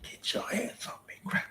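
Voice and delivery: breathy movie threat under the breath; whisper. Pretend. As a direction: hiss it like a cornered villain. Playing a movie villain.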